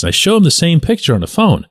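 usual speaking voice

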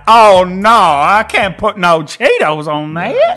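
grandma voice. Retold or imitated. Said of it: "And Granny'd be like".

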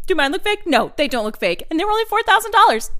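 usual speaking voice

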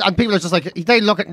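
usual speaking voice

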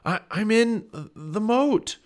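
high, loud, halting gasp; slow, strained cry from the water; gasping for air. A narrator's reading. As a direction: stammer it out while struggling for breath. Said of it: "gasping for air".

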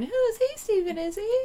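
high-pitched querying voice; exaggerated singsong puzzlement; puzzled listeners. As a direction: mimic a confused audience member up high. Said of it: "you out there are going".

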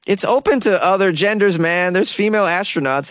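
ordinary talk

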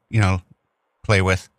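usual speaking voice

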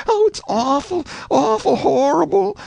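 high, wailing cry of horror; wailing crowd. A narrator's reading. Townsfolk in the courtroom wail at the news, high and wailing. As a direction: wail it out in dismay. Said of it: "busted out wailing and crying".

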